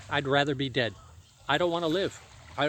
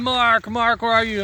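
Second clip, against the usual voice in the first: higher, louder calling out down a hall; retold drunk yelling for Mark; 6 semitones higher, 6 dB louder than usual. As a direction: call for a lost friend. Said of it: "I'm yelling".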